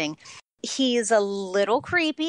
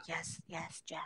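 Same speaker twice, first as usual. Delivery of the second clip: very soft, low aside; hushed urging; 5 semitones lower, 18 dB quieter than usual. Acted. Trying not to sway Jeff, hushed urging. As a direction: cheer someone on under your breath. Acting out silent urging of co-host.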